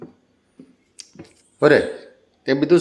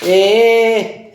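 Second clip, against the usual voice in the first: calling out; 8 semitones higher, 5 dB louder than usual. Real, not acted. Calls out to the pet.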